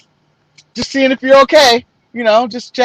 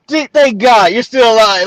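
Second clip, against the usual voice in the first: ordinary delivery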